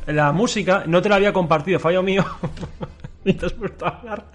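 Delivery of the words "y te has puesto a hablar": soft laugh through words; giggling quietly. Real, not acted. Laughing at music start.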